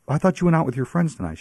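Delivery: high, baffled question; surprised. Retold or imitated. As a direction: sound thrown and confused. Disbelieving phone call retold.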